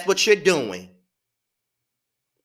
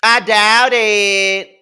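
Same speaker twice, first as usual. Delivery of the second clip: slower drawn-out scoff; 8 dB louder, much slower than usual. Real, not acted. Repeating the scoff.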